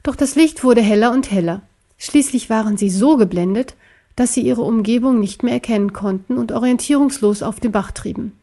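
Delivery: usual speaking voice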